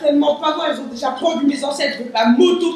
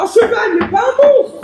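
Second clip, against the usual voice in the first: high, incredulous outburst; exasperated high-pitched retort; angry yelling; 6 semitones higher, 4 dB louder than usual. Acted. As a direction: yell the objection back, pitched up. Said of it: "arrêtez de crier".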